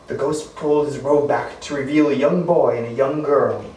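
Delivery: plain reading voice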